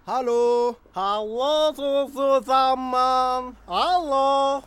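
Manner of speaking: put-on slow, slurred drunken greeting; blackout drunk. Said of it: "du bist stockbesoffen". (gespielt sturzbetrunken, lallend)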